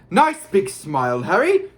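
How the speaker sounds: loud showman coaxing, high on the end; Gilderoy Lockhart. A narrator's reading. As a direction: coax it loudly through a toothy grin. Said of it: "said Lockhart through his own gleaming teeth".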